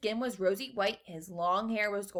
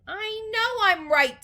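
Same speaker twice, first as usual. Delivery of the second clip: high, loud, drawn-out insistence; indignant; 13 semitones higher, 9 dB louder, slower than usual. A narrator's reading. Mother answers Mister Gloop, indignant. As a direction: insist on it loudly.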